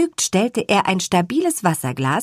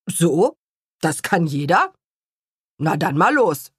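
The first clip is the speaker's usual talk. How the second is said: coolly; 2 semitones lower than usual. Acted. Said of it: "sagte Herr Kuchenbrand kühl".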